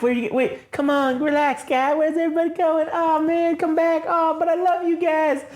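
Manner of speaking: hammed-up begging in a strained high voice; pleading. Man calls after everyone leaving him, mock pleading. Left-behind man begs others to return.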